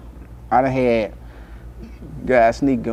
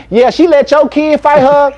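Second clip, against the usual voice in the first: snitching; 12 semitones higher, 8 dB louder than usual. Retold or imitated. Snitching to other mother.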